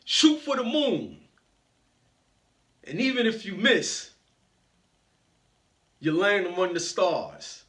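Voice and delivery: a hint of a motivational captain on the mic; Les Brown. Captain inspiring passengers over the speakers, lightly imitated powerful voice. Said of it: "this powerful voice comes over the mic".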